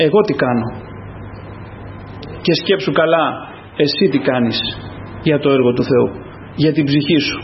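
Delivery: low, subdued preaching voice; searching question, pitched well down; sober self-examination. Real, not acted. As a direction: drop low and press the question home.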